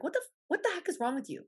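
high, incredulous what-the-heck; mock exasperation. Mocking people who change on camera, high and baffled. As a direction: act baffled at someone's fake camera self.

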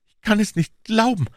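the grief breaks through; anguished moan. A narrator's reading. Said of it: "stöhnte er". (verzweifelt stöhnend, viel höher)

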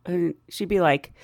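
ordinary talk, nothing marked

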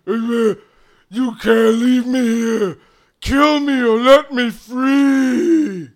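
loud, slow, high pleading yell; loud slow troll cry. Acted. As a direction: bellow it, begging. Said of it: "the troll starts yelling".

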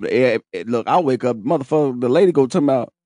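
usual speaking voice

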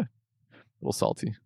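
breathy teasing whisper. Real, not acted. Whispering a tease to his co-host. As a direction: drop to a sly whisper.

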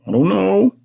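high-voiced child's meek answer; meek reply. A narrator's reading. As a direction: answer mother in a high little voice. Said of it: "I answered quietly".

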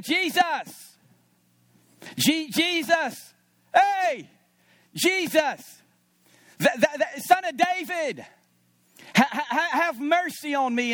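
high desperate calling out; crying out. Retold or imitated. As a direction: cry out to Jesus like a blind beggar. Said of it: "this voice is crying out".

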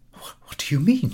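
faltering, whispered alarm. Acted. Friend alarmed about the necklace, whispering. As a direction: catch your breath and whisper it.

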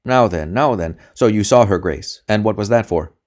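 loud, sharp questioning; sharp interrogator. A narrator's reading. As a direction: press the prisoner hard. Interrogator pressing hard.

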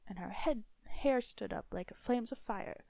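ordinary delivery

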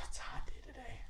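spaced-out whisper from a coworker on acid; stoned whisper. Retold or imitated. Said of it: "He's like".

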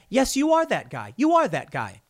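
imitating his own heated comeback, pitch jumping high at first; mock argument, high-pitched opening without a real shout. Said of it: "I raise my voice at him".

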